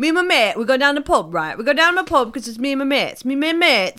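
loud, high mock Yorkshire rattle; Yorkshire accent. Imitating a shop colleague on going to the pub, hammed-up Yorkshire accent. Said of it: "proper Yorkshire accent that he said at a proper Yorkshire speed".

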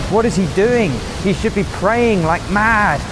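high, rushed alarm; panicked disbelief. Acted. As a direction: sound frantic at the sleeping man. Panicked fishermen watching Jesus sleep.

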